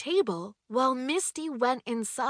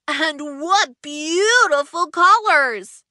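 louder, slow admiring exclamation; admiring Kiwi; 4 semitones higher, 8 dB louder, slower than usual. Acted. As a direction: admire the colors aloud.

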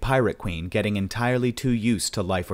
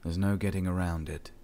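soft, flat, creepy killer's voice; the killer calmly cornering her; creepy monotone; 6 dB quieter than usual. A narrator's reading. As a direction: flatly tell her she's trapped. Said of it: "creepy English monotone".

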